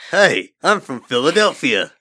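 silly gruff Philly guy; made-up Philadelphia accent. Acted. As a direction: belt out a badly faked Philadelphia accent. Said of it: "A gruff-voiced male with a pretty normal voice".

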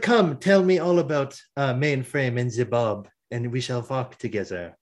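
put-on French accent, silky invitation; terrible French accent. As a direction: invite them along in a fake French accent. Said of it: "my terrible French accent".

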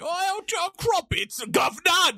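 hammed-up British accent, very high and loud, slow and stretched; mock British accent. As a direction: ham up a silly posh British caricature. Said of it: "I'm not gonna do the British accent".

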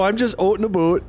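high Minnesota "oot and aboot"; Midwest accent. Retold or imitated. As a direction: play up the Midwest vowels. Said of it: "Midwest as fuck".

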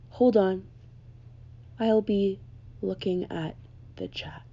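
slow, droning teacher voice trailing off soft; imitating lullaby teacher. Mimicking her online professor's sleepy morning greeting, slow and drowsy. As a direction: lull the class to sleep with every word. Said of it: "I'll give you a taste of what the class was like".

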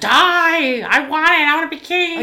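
high mock tantrum yell; mock a spoiled prince screaming; shouting brat king. Mocking villain prince's tantrum.

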